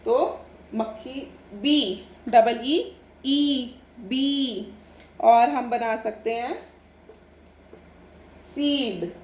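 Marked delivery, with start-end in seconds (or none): 2.3-4.7 ई in बी held very long and slow. Shown as a demonstration. Teacher spelling bee for children, long ee. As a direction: stretch the ee of बी. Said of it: "बी यानी मधुमक्खी".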